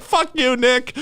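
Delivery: high mock angry squeal; mock angry commenter. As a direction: squeak it out like a furious troll. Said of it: "they're just like".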